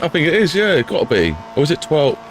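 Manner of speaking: normal talking voice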